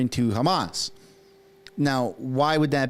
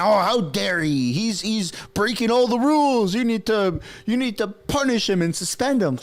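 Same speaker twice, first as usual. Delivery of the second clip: high, louder mock indignation; mock outrage; 8 semitones higher, 3 dB louder than usual. Vlogger mocking the outcry against Pierre, high and loud. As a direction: ham up their fake outrage. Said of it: "and all of a sudden".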